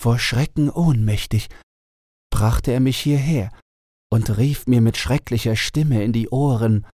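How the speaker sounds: the enchanted owl telling her sad tale; owl princess voice. A narrator's reading. Owl princess tells her story. (traurige Eulenprinzessin, etwas höher)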